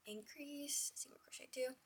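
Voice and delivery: muttered under her breath; soft whisper to herself; whispered counting. Real, not acted.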